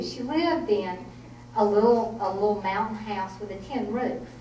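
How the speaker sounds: usual speaking voice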